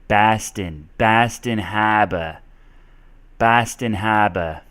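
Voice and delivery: "Baston Harbor" with short A and dropped R; Boston accent. Shown as a demonstration. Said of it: "an O becomes more of an A".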